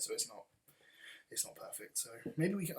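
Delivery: ordinary talk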